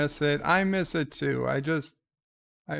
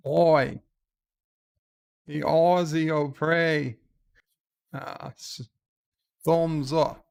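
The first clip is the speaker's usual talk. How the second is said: Aussie voice; 3 dB louder than usual. Pretend. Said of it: "Aussie voice".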